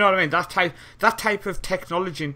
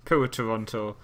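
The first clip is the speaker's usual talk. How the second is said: normal talking voice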